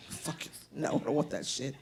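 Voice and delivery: quiet inner-voice aside; muttered aside. Retold or imitated. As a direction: mutter the true feelings aside.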